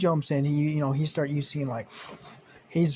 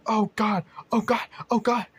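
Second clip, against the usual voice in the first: a higher, panicky mock yelp; panicking; 8 semitones higher, 4 dB louder than usual. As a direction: squeal in mock terror on the ride. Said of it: "Luke's like".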